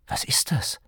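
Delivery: hushed, unvoiced asking; whispered question. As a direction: whisper it, uneasy. Ben asks, uneasy.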